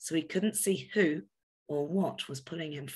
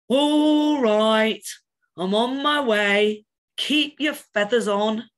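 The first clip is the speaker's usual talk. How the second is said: much louder, slow, grumbling robin; sleepy grumpy robin; 5 semitones higher, 9 dB louder, slower than usual. A narrator's reading. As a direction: grumble down from the tree, half awake. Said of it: "a sleepy and slightly grumpy voice".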